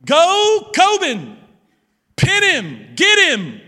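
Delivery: cheering a son on at the city championship; retold cheering yell. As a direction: shout him on like a clueless wrestling dad. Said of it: "So I'm like".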